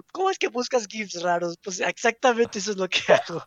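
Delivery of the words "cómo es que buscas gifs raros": mock complaint. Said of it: "me reclama de".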